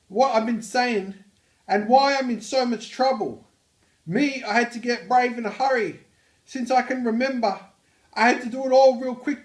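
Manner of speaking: loud, high-pitched Australian; Australian accent. Acted. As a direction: belt it out in a broad, hammed-up Aussie accent. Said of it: "proper use of an Australian accent".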